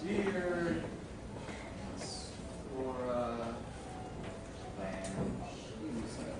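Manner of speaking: soft words with long gaps between them; writing aloud. Acted. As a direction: murmur each word as you write the note. I wanna write a note.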